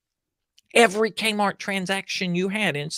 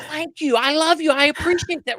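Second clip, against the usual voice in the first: higher, louder, effusive thanks; friend's warm thanks; 7 semitones higher, 4 dB louder than usual. Retold or imitated. Vicky thanking the barista, higher and effusive. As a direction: gush it higher. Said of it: "She goes".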